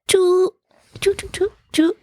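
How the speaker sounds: high squeaky chew chew; Japanese mouse squeak. Shown as a demonstration. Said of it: "the sound that mice make in Japan".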